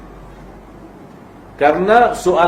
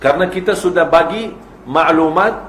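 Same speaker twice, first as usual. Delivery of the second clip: ordinary talk, nothing marked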